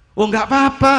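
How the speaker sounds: cheery reassurance. Retold or imitated. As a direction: wave off his concern brightly. Habib reassures the worried host. (menenangkan dengan ceria)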